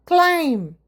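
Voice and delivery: ക്ലൈം said as klaim, no b at the end; ക്ലൈം with silent b, model pronunciation; silent b climb. Shown as a demonstration. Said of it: "ആ ബി silent ആയിട്ടാണ് എപ്പോഴും".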